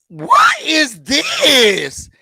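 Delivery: slow, loud, stretched out; appalled. Real, not acted. Seeing the cannoli pictures, slow loud disbelief. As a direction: drag it out in disbelief.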